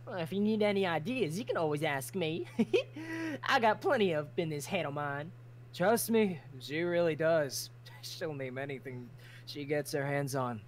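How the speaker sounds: normal talking voice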